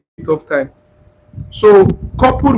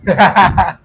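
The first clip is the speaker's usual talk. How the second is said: short run of laughter; quick laugh after a joke; real laugh. Real, not acted. Teacher after claiming a dinner date, laughing.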